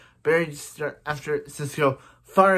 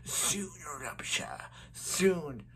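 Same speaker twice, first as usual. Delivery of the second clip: hushed, slow villain voice; very soft, slow menacing figure; Zoom voice; 11 dB quieter, much slower than usual. Acted. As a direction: draw it out softly like a sinister villain. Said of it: "I do a very good Zoom voice".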